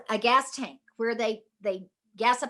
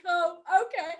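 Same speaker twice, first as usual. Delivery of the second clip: high, nervous laughing okay; a frightened little laugh through the words; squeaky, laughing, scared agreement; 5 semitones higher than usual. Retold or imitated. Timid reply after a threat.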